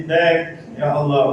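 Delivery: ordinary talk, nothing marked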